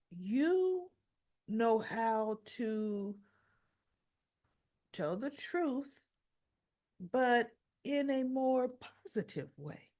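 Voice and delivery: slow, drawn-out persuasion; pointed coaxing. Retold or imitated. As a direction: spell it out slowly, with meaningful weight. Christina coaxes Molly to shade truth.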